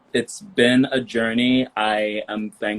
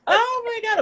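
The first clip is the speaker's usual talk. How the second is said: high laughing shriek of delight; thrilled laughing cry; excited squeal. Real, not acted. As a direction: shriek it with glee. Guest arrives, excited squeal.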